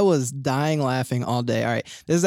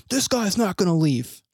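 mildly heated, higher-pitched mock complaint; retold grievance, voice pitched up a little; mad complainer; 4 semitones higher than usual. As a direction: imitate an annoyed guy tattling to the judge. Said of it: "now the guy's getting more mad".

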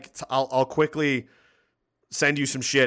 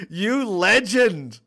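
a slow, stretched-out "You legend", higher and louder; drawn-out praise; 7 semitones higher, 6 dB louder, much slower than usual. Real, not acted. Streamer hails the fan who made the playlist, slow and drawn out. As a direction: savour each word of the praise.

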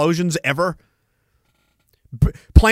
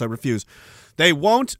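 ordinary delivery

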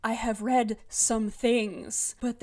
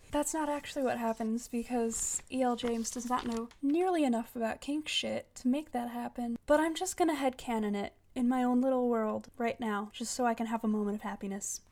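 ordinary talk

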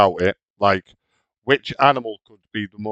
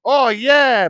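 high, swooping fake excitement; mock enthusiasm; 12 semitones higher, 4 dB louder than usual. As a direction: make it sound thrilling, hammed-up. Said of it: "try to make it sound like".

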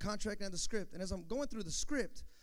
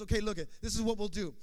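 fast talker; 2 semitones higher, faster than usual. Retold or imitated. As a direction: rattle it off like a slick producer. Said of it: "this guy was a fast talker".

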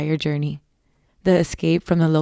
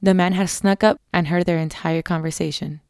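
plain reading voice

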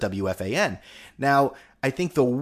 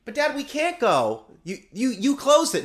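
put-on whiny kid voice; 11 semitones higher than usual. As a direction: plead like a disappointed child. Kid voiced in retold scene.

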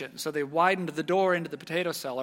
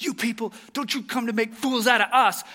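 higher, louder, offended outburst; angry soldier; 7 semitones higher, 6 dB louder than usual. Retold or imitated. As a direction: snap at the family for mocking him. Soldier thinks he's mocked.

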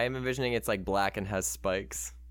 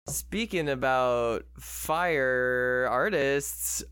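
slow drawn-out segue; much slower than usual. Acted.

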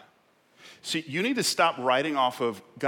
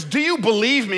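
loud, high heavenly challenge; 11 semitones higher, 9 dB louder than usual. Acted. God tests the man on the branch, calling out. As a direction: call the question down from above. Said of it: "The voice says".

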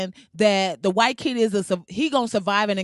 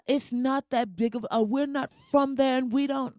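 higher, badly faked Kenyan accent; shitty Kenyan accent; 3 semitones higher than usual. Mocking the child's mother's statement, bad Kenyan accent. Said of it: "I'm giving her a shitty accent".

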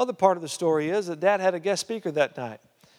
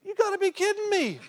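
much higher, incredulous exclamation; watching him fall, high-pitched astonishment; astonished disbelief. Retold or imitated. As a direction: squeak it out in amazement. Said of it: "I was like".

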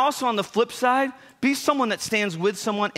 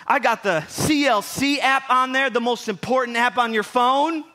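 ordinary talk, nothing marked

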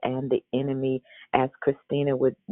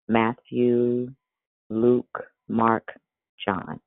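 ordinary delivery

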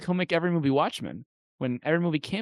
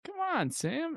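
high, wheedling coax; much higher pleading voice; coaxing plea; much slower than usual. Retold or imitated. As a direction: beg him sweetly to join. Pleading with Sam Jackson.

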